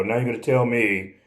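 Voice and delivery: ordinary talk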